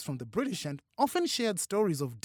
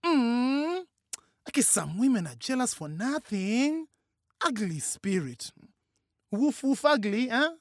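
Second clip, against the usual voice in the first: high, sing-song quip ending on a questioning rise; arch, high-pitched jibe with a questioning lilt; questioning, teasing quip; 8 semitones higher, 5 dB louder than usual. A narrator's reading. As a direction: quip it in a high, louder voice, inviting agreement. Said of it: "Her questioning inflection was an invitation".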